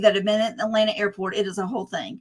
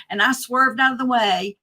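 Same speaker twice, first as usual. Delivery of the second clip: normal talking voice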